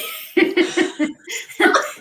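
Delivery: breathy rising laugh; an airy little laugh that rises. Real, not acted. Laughs about being called brave.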